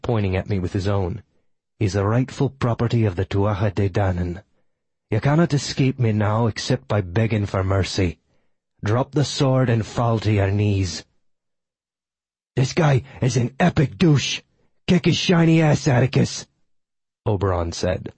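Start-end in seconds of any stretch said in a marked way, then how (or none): 1.8-11.0 stern enemy demanding surrender; villain's measured demand, slowing on the final order; commanding villain, composure regained. Acted. 12.6-16.5 cheering hound voice, pitch jumping up; eager dog sidekick, rising excitement; excitable talking dog, egging on. Acted.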